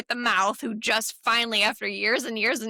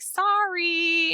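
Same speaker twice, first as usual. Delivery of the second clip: high apologetic sorry; 10 semitones higher, 4 dB louder than usual. Retold or imitated. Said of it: "and she's like".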